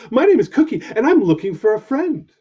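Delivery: bright hopeful pup saying hello; puppy voice. Acted. Puppy introducing himself to the lion, eager and high.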